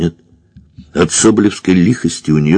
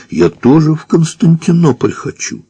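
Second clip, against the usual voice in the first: whining; 10 semitones higher than usual. A narrator's reading. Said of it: "взвыл прапорщик".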